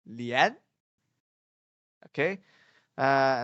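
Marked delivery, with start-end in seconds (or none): 0.1-0.6 "Lian" with a rising tune; second tone lian. Shown as a demonstration. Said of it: "how I gonna read it".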